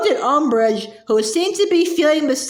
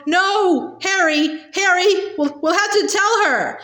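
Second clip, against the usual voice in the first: panicked plea; 6 semitones higher than usual. A narrator's reading. Hermione pleading in panic.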